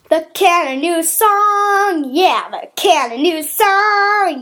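drawn-out segment intro; slow announcing. Acted. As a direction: stretch it like a jingle. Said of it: "announcing the segment song".

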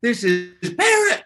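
high voice cracking after a throat clear; imitated voice giving out; losing voice. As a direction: push the words through a failing throat, acted. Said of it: "And I started losing my voice".

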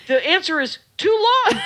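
high, emphatic cry on the punchline; exasperated punchline. Real, not acted. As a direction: pitch leaps up in mock outrage. Exasperated punchline about customer service.

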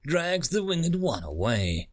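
ordinary delivery

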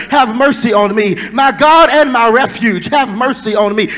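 rising call to the congregation, pleading with mounting force; full-force preaching. Acted. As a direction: whoop it up like the sermon's climax. Preaching climax, rising litany.

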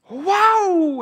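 high, loud wow as if launched; ejecting whoosh cry. Retold or imitated. Preacher acting Goose ejected into the sea, high loud wow. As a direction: yell like you're shot out of the jet.

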